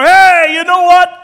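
loud confession bursting out; throwing off the mask. Retold or imitated. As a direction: blurt it out, all restraint gone. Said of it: "I throw off my mask".

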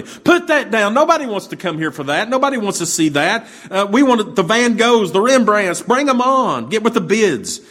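high-pitched angry heckle; angry shout. Retold or imitated. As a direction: yell it up at the auctioneer. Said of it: "Another voice shouted out angrily".

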